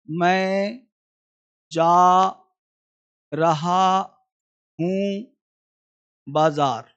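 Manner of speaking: word by word with long pauses; slow dictation. Shown as a demonstration. Teacher giving a Hindi line to write in English, slow dictation. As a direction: dictate each word separately, drawn out.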